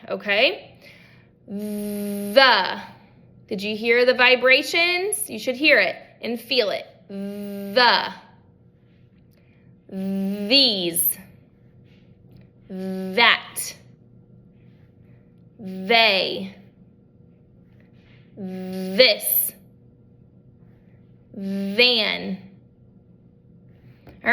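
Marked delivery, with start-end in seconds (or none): 1.4-2.8 "The" with a buzzing voiced th, said lower; voiced TH demo. Shown as a demonstration. Therapist modeling noisy th so the student feels the vibration. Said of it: "a few noisy T H words to try". 7.1-22.4 "The", "These", "That", "They", "This", "Than" each with a buzzing voiced th, lower and isolated; voiced TH demos. Shown as a demonstration. Therapist modeling noisy th words for a student, lower and buzzing. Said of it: "a few noisy T H words to try".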